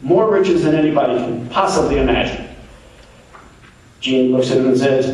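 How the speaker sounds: normal talking voice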